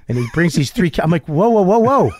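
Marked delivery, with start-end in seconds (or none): 1.3-2.1 higher rapid-fire protest, half laughing; mock alarm. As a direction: act out panicked backpedalling. Acts out alarm as kids arrive.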